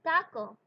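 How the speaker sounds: taco with a crisp t and no puff; Spanish t demo; Spanish unaspirated t. Shown as a demonstration. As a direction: keep air off the t of taco. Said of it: "let's try with taco".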